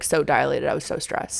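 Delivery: ordinary talk, nothing marked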